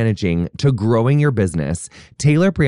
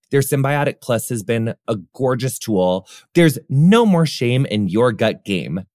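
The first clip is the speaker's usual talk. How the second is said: ordinary delivery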